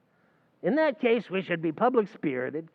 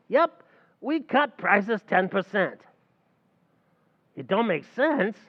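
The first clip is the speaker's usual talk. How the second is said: usual speaking voice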